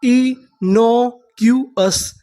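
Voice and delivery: very slow, drawn-out reading; innocuous drawn out slowly, syllable by syllable; slow syllable demo. Shown as a demonstration. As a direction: stretch each syllable of innocuous. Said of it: "तो हमारी पूरी meaning क्या बनी?".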